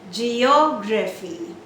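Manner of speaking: Geography slow with long, loud OG; extended stressed syllable. Shown as a demonstration. Teacher extends for learners, long stress on OG in Geography. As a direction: stretch OG in Geography. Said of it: "I'm extending just for you".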